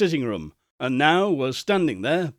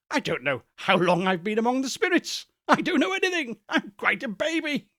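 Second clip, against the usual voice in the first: giddy wonder; 6 semitones higher than usual. Acted. Scrooge giddy, bewildered.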